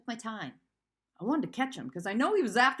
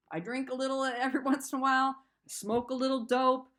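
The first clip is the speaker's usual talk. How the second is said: ordinary delivery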